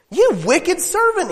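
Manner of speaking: high, stern rebuke from the master; angry rebuke. A narrator's reading. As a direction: scold the servant harshly. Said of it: "he said".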